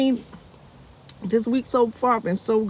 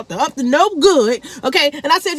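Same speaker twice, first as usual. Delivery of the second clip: ordinary delivery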